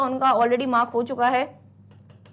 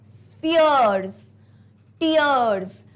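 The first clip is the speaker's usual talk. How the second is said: Spheres drawn out slowly, then tears; rhyme sounded out; much slower than usual. Shown as a demonstration. Teacher rereads the words so students hear the rhyme. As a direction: stretch the words so the rhyme shows. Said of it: "एक बार पढ़ो फिर से".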